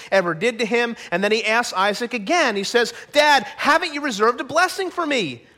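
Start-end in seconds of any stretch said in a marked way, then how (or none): 3.1-5.4 higher-pitched, indignant appeal to dad; acted wronged son, pitch raised; aggrieved plea. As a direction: plead with injured urgency. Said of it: "Esau goes on a tirade".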